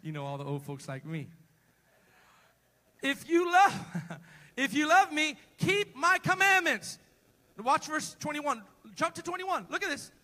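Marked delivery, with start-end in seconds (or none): 3.0-7.0 quoting scripture at full voice, much louder and higher; loud preaching. Real, not acted. Preacher booming the scripture to the congregation. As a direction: proclaim it to the church.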